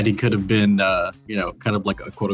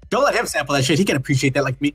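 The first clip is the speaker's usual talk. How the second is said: high, urgent protest; agitated objection; 5 semitones higher, faster than usual. Acted. Guard told not to share the goods, agitated. NPC objects to sharing the drugs.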